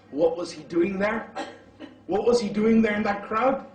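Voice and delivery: rising question. Real, not acted. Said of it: "rhetorical build to congregation".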